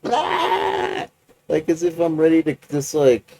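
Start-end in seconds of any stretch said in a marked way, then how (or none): 0.1-1.1 very high sharp "ah" yelp; manic outburst. Retold or imitated. Vlogger showing viewers how jacked up he feels, very high yelp. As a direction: burst out with a frantic high "ah". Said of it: "It's like".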